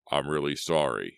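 usual speaking voice

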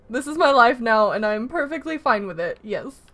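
usual speaking voice

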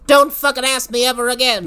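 hammed-up granny threat; menacing granny. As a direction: warn them off like a gun-toting granny. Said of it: "She pumps the rifle real fast".

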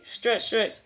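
ordinary delivery, nothing marked

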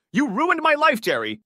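high, loud anguished yell. A narrator's reading. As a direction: scream it at him. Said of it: "Karen yelled".

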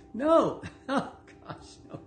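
breaking into a high, breathless laugh. Real, not acted. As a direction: giggle through the words, pitch jumping up. Laughs at own point.

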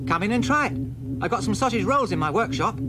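put-on boy inviting aliens inside; friendly boy voice. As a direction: welcome them in gladly. Booster invites.

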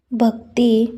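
usual speaking voice

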